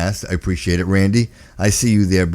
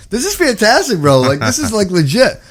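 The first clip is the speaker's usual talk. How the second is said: excited; 12 semitones higher, 4 dB louder than usual. Real, not acted. Host thrilled by fan comment.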